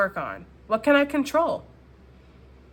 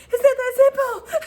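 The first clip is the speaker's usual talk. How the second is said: very high, loud, hammed-up protest; Notebook heroine; 8 dB louder than usual. Vlogger plays The Notebook heroine protesting, very high and loud. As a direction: cry it out like a melodramatic heroine. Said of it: "And she's like".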